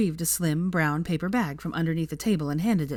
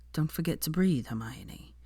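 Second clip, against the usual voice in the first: soft, dropping to a whisper; calm her down, nearly whispered; gentle; 5 dB quieter than usual. Acted. Draco calming Hermione.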